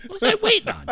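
high, squeaky self-mocking question; mock yourself in disbelief. Real, not acted.

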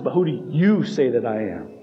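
single out the listener with a weighted "you"; emphasizing YOU. Acted. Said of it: "making direct eye contact and emphasizing".

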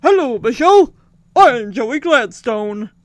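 loud, slowed-down cartoon moose voice; Bullwinkle voice. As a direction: boom it out like a goofy cartoon moose. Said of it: "Joey does the whole Bullwinkle".

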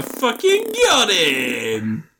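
high, loud, drawn-out crow; mock triumph. Host gloating during podcast sign-off, drawn-out triumphant crow.

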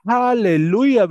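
loud, high joyful hallelujah; voicing Jesus. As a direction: cry it out jubilantly. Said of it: "würde Jesus sagen". (laut jubelndes Halleluja als Jesus)

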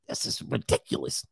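hushed, low mock disbelief; softly aghast; stunned disbelief. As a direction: mutter it as if taken aback. Acted man taken aback.

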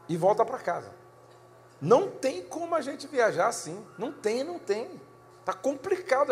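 fed-up husband laying down the law; irritated ultimatum. Retold or imitated. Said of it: "Aí eu disse".

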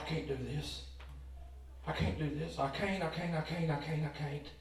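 put-on a little boy losing his nerve at bat; scared. Boy at bat tells himself he can't, scared and soft. Scared batter thinking at the plate.

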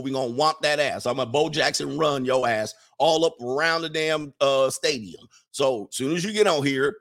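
ordinary talk, nothing marked

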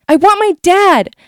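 high, loud, slow scream for dad. Retold or imitated. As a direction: scream it out, refusing help. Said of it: "I scream".

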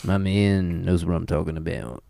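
low mumbled Australian [name]. Acted. Host riffing for laughs, low Australian [name] voice. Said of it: "That was Australian [name]".

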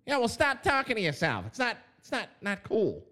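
high voice for someone scolding him; different voice. Retold or imitated. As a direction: pitch it way up as the other guy. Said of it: "I use a different voice".

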